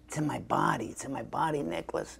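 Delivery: intense brother. Retold or imitated. Brother explaining his method, hushed and intense. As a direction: murmur it like a mantra. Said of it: "he's kind of intense".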